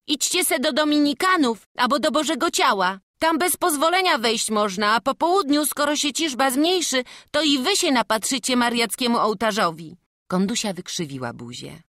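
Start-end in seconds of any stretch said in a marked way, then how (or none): 0.1-10.0 lively young helper, voiced higher; bright eager lad speaking to the family; character voice. Young church helper inviting the family in, eager.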